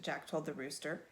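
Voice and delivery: ordinary delivery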